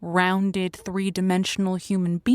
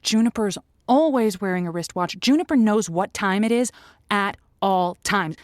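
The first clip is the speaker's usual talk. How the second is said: ordinary talk, nothing marked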